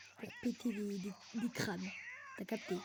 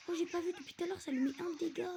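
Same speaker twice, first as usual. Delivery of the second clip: agitated outburst; 8 semitones higher, 4 dB louder than usual. Real, not acted. Surprised by damage in the game.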